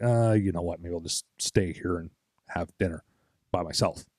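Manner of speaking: a buddy who feels left out; mild hurt friend, voice dropping away; dejected buddy. Retold or imitated. Sulking buddy declines dinner.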